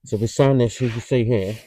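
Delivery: muffled behind a respirator mask; talking through mask. Real, not acted. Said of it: "Let me take my mask off. You can hear me a bit better".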